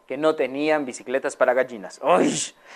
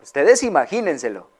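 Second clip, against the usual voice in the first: usual speaking voice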